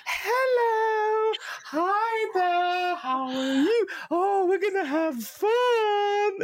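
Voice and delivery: very high, loud, crying-out jester voice; squeaky gnome jester. Retold or imitated. DM voicing the annoying gnome jester to players, shrill squeal. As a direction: shriek it like a manic gnome clown. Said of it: "and just talk like this".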